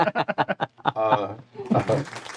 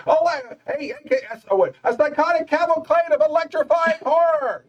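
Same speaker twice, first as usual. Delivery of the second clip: much higher, frantic monster-truck announcer; high-pitched announcer; 13 semitones higher than usual. Retold or imitated. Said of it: "the really high-pitched voice".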